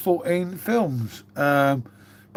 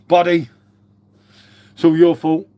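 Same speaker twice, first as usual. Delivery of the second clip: loud mock blame; 7 dB louder than usual. Real, not acted. Blaming a viewer after outburst.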